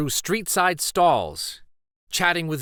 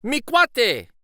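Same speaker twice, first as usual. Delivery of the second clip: higher, louder eager greeting; excited shout back; 9 semitones higher, 3 dB louder than usual. Acted. Boy answering his friend on the bridge, excited call. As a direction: call back to your buddy, delighted.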